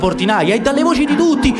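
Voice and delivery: plain reading voice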